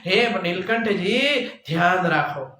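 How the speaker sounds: ordinary talk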